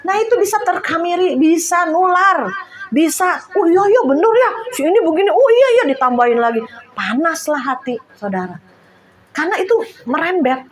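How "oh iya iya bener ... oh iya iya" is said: high, loud, eager agreeing; mock eager agreement. Speaker mimics friends feeding each other's grumbling, mock eager agreement. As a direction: imitate gossips piling on, higher and louder. Acts out friends egging on gossip.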